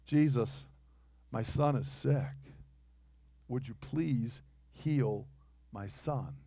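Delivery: quiet humble plea; pleading softly. Retold or imitated. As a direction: ask softly, hat in hand. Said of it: "and he says".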